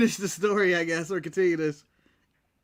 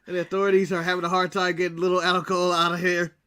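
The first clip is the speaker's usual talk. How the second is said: ordinary talk, nothing marked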